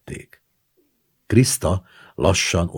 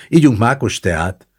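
high, loud invitation; cried out; 7 semitones higher, 7 dB louder than usual. A narrator's reading. Kriszta inviting all to poppy-seed tea, calling out. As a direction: call everyone to drink. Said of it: "kiáltott Kriszta".

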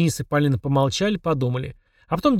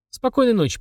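high sweet goodnight; 10 semitones higher than usual. A narrator's reading. Children saying goodnight.

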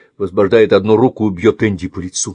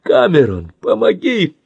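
much higher cry for help; desperate plea; 7 semitones higher, 3 dB louder than usual. Acted. Andy begs Cameron for help, desperate plea. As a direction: call for rescue.

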